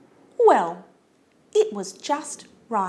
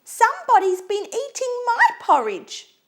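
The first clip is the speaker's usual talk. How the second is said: very high, loud little bear squeak; squeaky Baby Bear; 5 dB louder than usual. A narrator's reading. Baby Bear finds his bowl empty, high loud squeak. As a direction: squeal it like an upset little cub. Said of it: "he squeaked".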